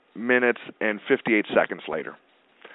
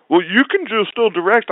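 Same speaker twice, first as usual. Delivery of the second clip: mock wheedling; 10 semitones higher, 4 dB louder than usual. Comic guy backpedaling.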